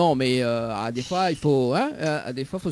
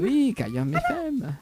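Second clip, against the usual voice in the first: slow, high sensual drawl on Cayam F M; 9 semitones higher, slower than usual. Shown as a demonstration. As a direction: purr the station name slowly. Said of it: "j'ai une voix sensuelle".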